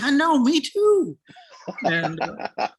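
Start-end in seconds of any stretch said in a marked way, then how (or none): 0.0-1.2 high, loud, giddy squeal; excited squeal. Retold or imitated. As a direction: gush like a fangirl. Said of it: "And I'm like".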